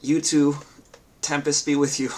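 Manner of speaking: put-on lightly accented captain rallying her troops; NPC accent. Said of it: "I don't wanna speak anymore in her accent".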